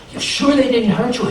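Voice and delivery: concerned neighbors. A narrator's reading.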